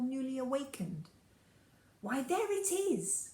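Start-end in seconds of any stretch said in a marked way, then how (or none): 2.0-3.3 rising glad exclamation, slowed; joyful cry. Acted. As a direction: cry out in sudden recognition. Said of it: "cried the prince".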